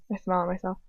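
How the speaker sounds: ordinary delivery, nothing marked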